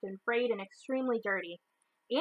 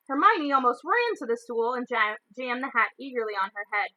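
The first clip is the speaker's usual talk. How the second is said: ordinary delivery, nothing marked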